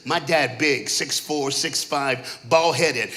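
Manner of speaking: ordinary talk, nothing marked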